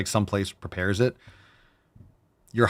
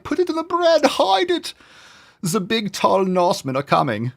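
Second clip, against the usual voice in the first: high, loud mock-panicked German accent; German guy; 13 semitones higher, 4 dB louder than usual. Villager hiding meat from Vikings, shrill mock-German alarm. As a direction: cry out a warning in a hammed-up German accent. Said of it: "a German guy".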